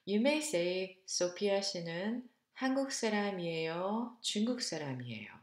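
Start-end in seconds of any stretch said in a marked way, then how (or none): 1.1-4.2 한국 사람이에요 lifted, pitch climbing at the end; rising first choice. Shown as a demonstration. Teacher modeling a Korean choice question, rising tune. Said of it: "intonation goes up in the first choice". 4.2-5.3 중국 사람이에요 dropping low and quick at the end; falling second choice. Shown as a demonstration. Teacher modeling the second choice for students, falling tune. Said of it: "go down now in the second choice".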